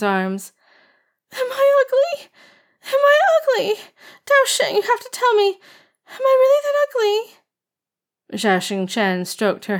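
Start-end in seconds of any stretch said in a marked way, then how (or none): 1.3-2.3 put-on high wailing young girl. A narrator's reading. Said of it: "started wailing". 2.9-3.7 put-on high, louder girlish wail. A narrator's reading. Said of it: "started wailing". 4.3-8.4 put-on high sobbing young girl. A narrator's reading. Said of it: "She cried with both tears".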